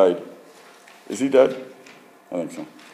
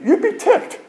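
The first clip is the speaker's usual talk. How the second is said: offended; slower than usual. Pretend. Voicing offended letter writer.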